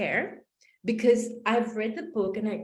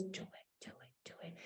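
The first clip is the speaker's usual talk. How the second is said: inner voice whisper; 23 dB quieter, faster than usual. Retold or imitated. Inner voice urging her to take the leap, whispered. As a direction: hiss it like a voice inside your head. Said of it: "this voice that says".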